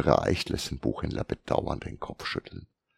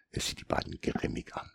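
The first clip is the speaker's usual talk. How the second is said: ordinary delivery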